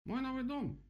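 very high lamenting cry. A narrator's reading. Woodpecker mourns his new house, lamenting. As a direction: wail it high, in mild despair. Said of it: "сокрушался дятел".

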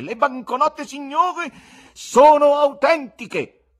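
loud, high goatish tenor, drawn out at the end. A narrator's reading. Showman assuring the audience the banknotes are real, goat-like tenor. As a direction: proclaim it in a bleating tenor. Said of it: "con alta voce tenorile da caprone".

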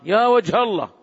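mock astonished outburst; astonished exclamation. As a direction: imitate someone amazed at something. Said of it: "إذا استغرب أمرا قال".

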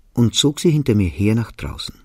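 ordinary delivery

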